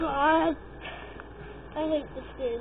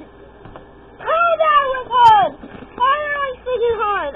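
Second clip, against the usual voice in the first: startled outcry; 6 semitones higher, 5 dB louder than usual. Real, not acted. Shock at hard part.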